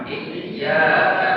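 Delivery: Iyyaka with a firm stress on yya; firm tasydid. Shown as a demonstration. Teacher models tasydid for the student, firm stress. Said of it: "Jangan polos bacanya".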